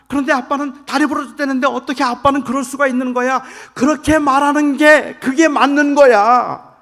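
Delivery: high, loud furious yelling at his son; angry shout. Retold or imitated. Father yelling at his injured son in the car, furious shout. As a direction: shout it at the boy in anger. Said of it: "소리를 질렀어요".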